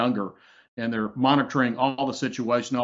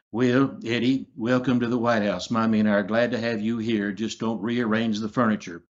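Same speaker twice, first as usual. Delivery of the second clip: Ronald Reagan; 2 semitones lower than usual. Acted. Host behind a guest at the portrait, Reagan impression. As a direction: play a genial old-time president welcoming guests. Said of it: "that's really good Reagan".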